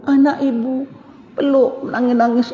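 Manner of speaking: mock weepy mother. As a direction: ham up the sobbing drama mother's call. Mother in drama voiced again.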